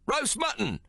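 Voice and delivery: high hawker's cry of roast mutton; shouted call. Acted. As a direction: call it out over the din. Waiters' call in crowded tent.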